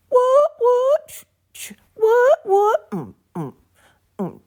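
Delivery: very high, loud squeaky cries; squeaky cartoon yelps. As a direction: yelp like a squeaky cartoon toy. Silly dance noises.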